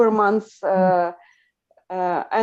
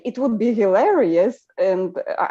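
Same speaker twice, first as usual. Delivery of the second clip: ordinary talk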